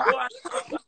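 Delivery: high-pitched laughing jeer; gleeful mocking laugh through the words; laughing taunt. Real, not acted. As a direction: cackle it out at her.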